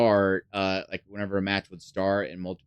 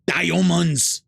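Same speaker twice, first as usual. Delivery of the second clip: loud, high mock-announcer shout of Diamonds; 10 semitones higher, 7 dB louder than usual. Brothers randomly imitating the announcer, loud shout. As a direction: yell it out like the game announcer. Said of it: "look at one another and go".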